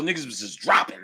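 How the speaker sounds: ordinary talk, nothing marked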